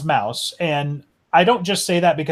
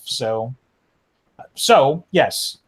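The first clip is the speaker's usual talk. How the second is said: ordinary delivery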